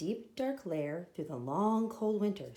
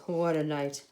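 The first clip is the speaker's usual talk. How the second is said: mole mutter; 4 semitones lower, 4 dB louder than usual. Acted. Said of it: "Mole mutters".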